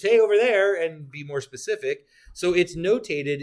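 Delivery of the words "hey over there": louder, higher "Hey, over there" as if pointing across a room; a light imitation of shouting and pointing; mock calling out. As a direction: call it out playfully like hailing someone. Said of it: "other than just".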